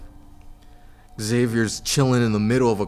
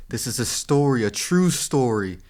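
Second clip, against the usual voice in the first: normal talking voice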